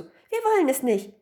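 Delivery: high, loud dismissal; high-pitched emphatic rejection; eager refusal. A narrator's reading. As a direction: chime in to be rid of it. Women eagerly give the mirror away.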